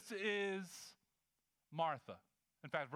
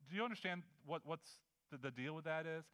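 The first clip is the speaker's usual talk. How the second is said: ordinary talk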